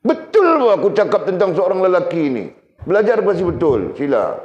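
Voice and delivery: emphatic insistence. Real, not acted. Insisting to audience.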